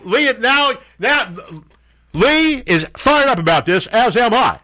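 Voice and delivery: high, hammed-up indignation; jokey high-pitched protest; mock outrage. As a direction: play up being fired up. Mock outrage for sidekick Lee.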